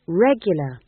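loud one-word stern insistence; stern, emphatic afterthought. A narrator's reading. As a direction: add it firmly and sternly. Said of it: "said Magrat sternly".

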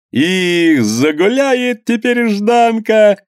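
very high, drawn-out whoop; merry shout. Acted. Man in the crowd foretells a spree, merry shout. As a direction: crow it out gleefully. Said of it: "весело крикнул другой".

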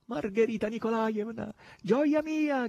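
high, excited cry of adoration; joyful shouting. A narrator's reading. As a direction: call out to your mistress in high glee. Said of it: "gridava Natascia". (grido acuto e festoso)

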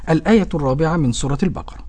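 usual speaking voice

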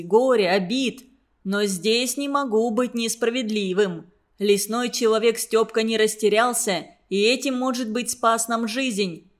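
ordinary delivery

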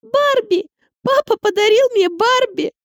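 put-on high, thrilled little girl; squealing with delight. A narrator's reading. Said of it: "запищала от восторга".

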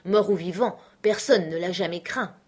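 ordinary delivery

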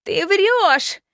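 shout. Acted. Princess jumps up accusing.

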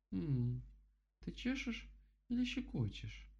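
very high giggly bear voice; ticklish bear. Acted. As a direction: squirm as if tickled.

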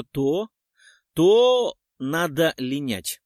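usual speaking voice

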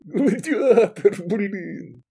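high, slow, laugh-choked exclamation; joyful hollering. A narrator's reading. Man joining his friend's guffaws, joyful. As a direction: crow it merrily, cracking up. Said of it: "жизнерадостно орал Сергей".

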